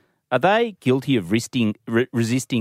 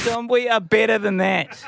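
mock scolding shout; 9 semitones higher than usual. Host scolds co-host over sketch.

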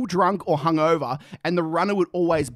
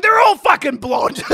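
exasperated; 10 semitones higher, 6 dB louder than usual. Retold or imitated. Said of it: "I'm like".

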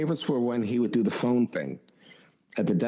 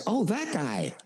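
Johnny's reaction; 11 semitones higher, slower than usual. Retold or imitated. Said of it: "And he said".

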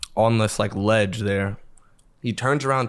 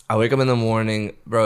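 usual speaking voice

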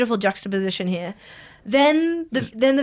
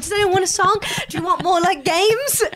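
high, eager retold offer to the crowd; padding host; 8 semitones higher than usual. Comedian left alone on the gig, high stalling host patter. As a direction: stall the audience with strained eagerness. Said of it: "I'm padding".

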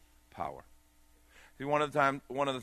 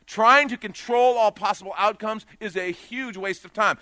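loud, rising preacher's call, higher; preaching at full force; 8 semitones higher, 10 dB louder than usual. Acted. Preacher urging the congregation, preaching at full force. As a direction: drive the point home to the whole church.

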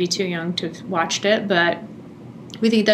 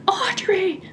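high, rising yell of her name, twice; mock yell; 13 semitones higher, 3 dB louder, much slower than usual. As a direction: call her out in exasperated alarm. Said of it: "yelling at her".

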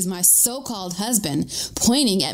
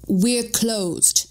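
hint of a Norwegian accent, proclaimed; lightly voiced Norwegian, like issuing an edict; Norwegian-accented proclamation, understated; 2 semitones higher than usual. A narrator's reading. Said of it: "she proclaims in her Norwegian accent like it's an edict".

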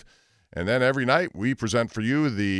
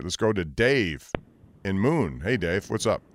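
ordinary talk, nothing marked

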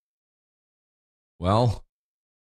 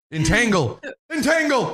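laughing shout; 8 semitones higher than usual. Acted.

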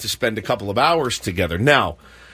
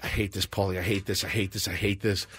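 miserable mutter; 6 semitones lower, 10 dB quieter than usual. Retold or imitated. Miserable at the empty table.

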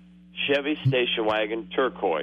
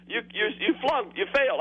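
mock examiner verdict; 8 semitones higher than usual. Caller voicing the examiner.

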